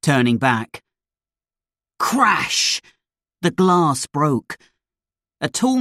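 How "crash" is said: sharp voiced crash sound. A narrator's reading. Narrator sounding out a crash, high. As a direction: make the glass crash. Sound effect in story reading.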